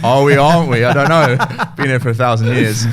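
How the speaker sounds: laughing through the words; chuckling while joking about his family name. Real, not acted. Guest laughing about his old family name.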